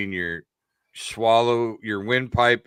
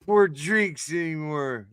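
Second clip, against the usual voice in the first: lovestruck suitor; 6 semitones higher than usual. Acted. Acted suitor wooing a bartender.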